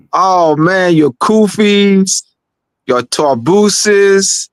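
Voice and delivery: high, slow, drawn-out announcer hype; grand host welcome to the audience; hype show host. Acted. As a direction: stretch each headwear like a big show opener. Hyped show opener.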